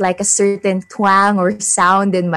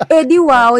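higher, louder sarcastic "wow"; mocking taunt; 6 semitones higher, 6 dB louder than usual. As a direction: sneer the words out sarcastically. Mocking envious classmates' taunt.

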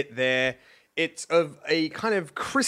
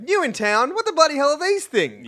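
higher, broad Darwin local; Aussie local voice; 10 semitones higher than usual. Retold or imitated. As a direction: bark it like a Top End local.

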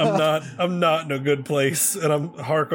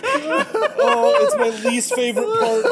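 high, half-laughing, half-crying panic; 9 semitones higher than usual. Real, not acted. Player dreading the spider's voice, laughing panic. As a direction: beg through helpless, squealing laughter. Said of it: "might actually be having a small panic attack".

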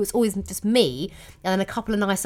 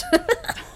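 high laugh. Real, not acted. Laughing at the twin comment.

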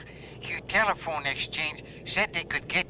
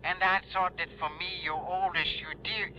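put-on French accent. Louis guilt-tripping Johnny.

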